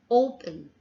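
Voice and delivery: "Open" as O-pun; strong "O", weak schwa in the end of "Open"; stress on first syllable. Shown as a demonstration. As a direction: lean on the start of "Open". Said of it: "the first syllable".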